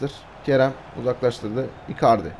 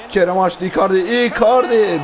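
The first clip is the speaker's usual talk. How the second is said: excited shout; 6 semitones higher, 5 dB louder than usual. Real, not acted. Gamer shouting his striker's name at a big chance, long and high. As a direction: yell the name long as the shot comes.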